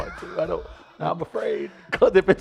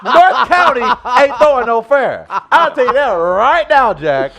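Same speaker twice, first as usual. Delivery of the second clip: laughing loud rant; 8 semitones higher, 6 dB louder than usual. Acted.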